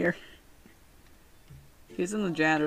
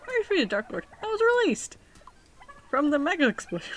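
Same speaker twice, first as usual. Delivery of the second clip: voiced ex villain; 9 semitones higher, faster than usual. Voicing ex Dark Lord.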